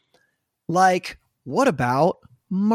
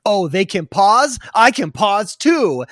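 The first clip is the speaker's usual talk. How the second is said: slow, louder mock-surprised goldfish voice; the fish catching on and drawing it out, hammed-up; goldfish realizing; 5 semitones higher, 9 dB louder, slower than usual. Host imitating the ad's goldfish discovering he can pause, slow and loud. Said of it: "He's like".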